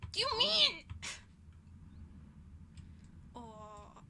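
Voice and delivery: usual speaking voice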